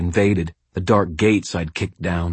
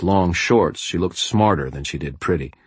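ordinary delivery

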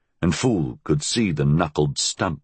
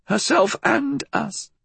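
put-on high, sharp old woman; old crone voice.

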